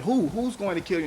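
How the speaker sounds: high-pitched retold demand; urgent questioning. Prosecutor voices officer questioning the woman, urgent. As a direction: act out the officer's startled, insistent question.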